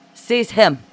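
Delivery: high, loud imperious command; sharp commanding call; imperious order. A narrator's reading. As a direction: order it like a haughty ruler. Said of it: "Juana ordered imperiously".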